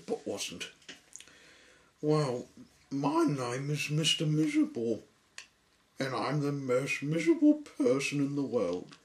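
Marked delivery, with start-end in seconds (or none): 2.0-9.0 1 miserable character voice. Acted.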